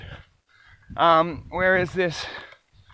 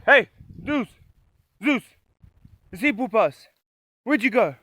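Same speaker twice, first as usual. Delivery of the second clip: calling the dog; 5 semitones higher than usual. Retold or imitated. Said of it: "I yell at him".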